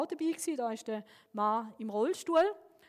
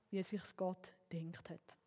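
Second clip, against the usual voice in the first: whispered; 4 semitones lower, 9 dB quieter, slower than usual. Real, not acted. Closing thought.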